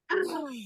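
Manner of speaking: sharp breath drawn in; audible gasp of air; deep gasp. Shown as a demonstration. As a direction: show the big inhale. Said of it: "now do it again three times".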